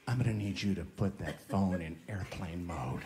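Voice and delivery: a hint of a smarmy flight attendant, low and soft; lightly mock a prim attendant; understated smarmy stewardess voice; smarmy sky waitress. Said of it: "that sky waitress voice".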